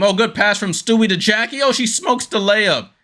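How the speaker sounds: thrilled commentator call; excited call. Real, not acted. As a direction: lift the voice at the big play. Commentator reacts to a great play.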